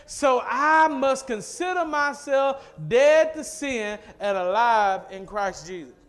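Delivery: emphatic slow recitation. Shown as a demonstration. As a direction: hammer the verse home for the congregation. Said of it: "Let's say that again".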